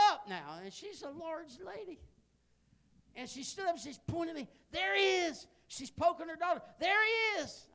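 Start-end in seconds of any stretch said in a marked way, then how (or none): 4.7-5.5 high, loud calling out; excited shout. Retold or imitated. Woman calling out, poking daughter. 6.8-7.7 high, loud calling out; excited shout. Retold or imitated. Woman calling out again.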